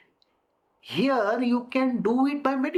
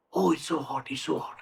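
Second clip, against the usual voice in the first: complaining of heat; 6 semitones lower, 4 dB quieter, faster than usual. Shown as a demonstration. Acts someone overheated.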